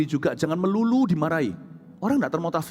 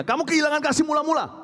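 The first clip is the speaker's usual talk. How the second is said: much higher sharp reproach; God rebuking; 10 semitones higher than usual. Acted. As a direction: call them out from on high. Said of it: "Tapi Tuhan langsung tegur". (teguran keras dengan suara tinggi)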